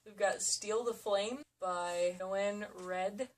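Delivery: usual speaking voice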